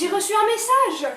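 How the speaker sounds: loud, high burst of news; excited. Acted. Daughter telling her mother about the message, excited. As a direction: blurt it out breathless with hope.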